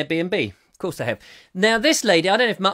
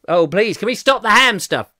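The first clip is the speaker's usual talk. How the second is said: louder, higher hammed-up begging; mock plea; 5 semitones higher, 6 dB louder than usual. Host pleads with listeners to drop the ham talk, hammed up. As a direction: beg them to stop, hamming it. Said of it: "I'm hamming it up enough".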